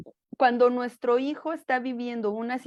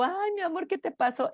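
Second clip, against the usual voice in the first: tender comforting; 7 semitones higher, 3 dB quieter than usual. Retold or imitated. Repeats comforting voice.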